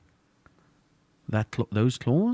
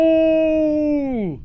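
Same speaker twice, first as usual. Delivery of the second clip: high, loud sustained "oooh"; one long held howl, dropping at the end; long eerie howl; 9 dB louder than usual. Acted. Game master makes eerie howl.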